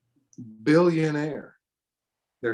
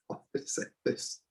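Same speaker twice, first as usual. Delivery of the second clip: breathy laughing aside; laughing whisper; 10 dB quieter than usual. Real, not acted. Preacher laughing at silly teaching, whispered.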